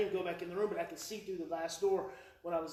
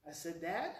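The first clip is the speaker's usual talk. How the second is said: ordinary talk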